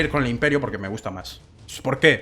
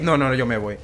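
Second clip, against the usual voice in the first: ordinary talk, nothing marked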